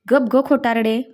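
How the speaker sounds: ordinary delivery, nothing marked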